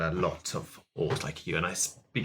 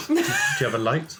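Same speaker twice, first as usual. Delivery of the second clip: cracking up, much higher; laughing; 5 dB louder than usual. Real, not acted. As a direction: breaks into laughter mid-question. Player laughs mid-line.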